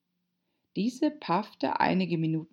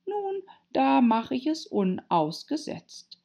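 put-on high-pitched girl reciting verse; high, measured poem recital; Alice reciting; 7 semitones higher than usual. A narrator's reading. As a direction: recite it like a schoolgirl.

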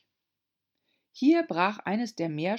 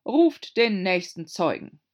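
commanding; 2 semitones higher, 8 dB louder than usual. A narrator's reading. King commands next witness.

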